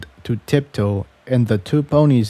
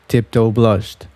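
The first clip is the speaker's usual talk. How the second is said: usual speaking voice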